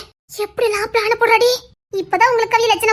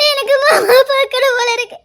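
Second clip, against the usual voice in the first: breaking into sobs; 5 semitones higher than usual. Acted. Aunt sobbing to her niece that she misses her husband. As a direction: voice cracking with tears as she repeats it.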